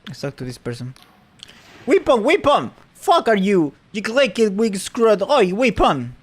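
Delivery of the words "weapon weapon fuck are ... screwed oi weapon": loud, higher frantic calls for a lost pet; shouting. Acted. Man calling out loudly for his lost pet. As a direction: bellow the pet's name across the street.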